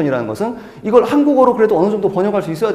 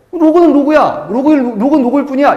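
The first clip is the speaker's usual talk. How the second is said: higher, louder stubborn insistence; stubborn student; 5 semitones higher, 4 dB louder than usual. Retold or imitated. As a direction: play the student who won't connect ideas. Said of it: "이런 사람은". (고집스러운 학생 흉내, 높고 크게)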